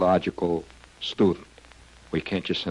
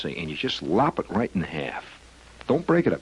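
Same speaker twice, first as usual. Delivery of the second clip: ordinary talk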